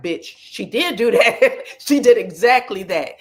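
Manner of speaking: laughing disbelief. Real, not acted. Reacting to Tamar laying it out, laughing loudly. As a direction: cackle it out in stunned delight. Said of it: "I was like".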